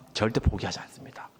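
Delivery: soft whispered resolve; whispered vow. Acted. Pastor quietly vowing never to give up, whisper.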